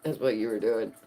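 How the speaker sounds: normal talking voice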